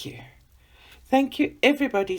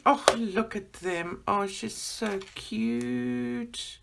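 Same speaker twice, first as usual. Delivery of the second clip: ordinary talk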